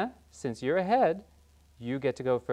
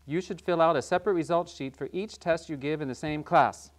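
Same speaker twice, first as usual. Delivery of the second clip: ordinary talk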